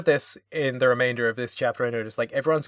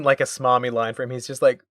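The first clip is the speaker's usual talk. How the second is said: usual speaking voice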